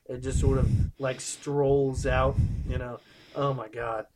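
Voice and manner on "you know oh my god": ordinary talk, nothing marked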